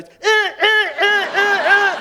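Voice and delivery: very high, loud honking uh sounds; loud drunk mimicking the car alarm; drunk alarm call. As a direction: bark back at the car alarm.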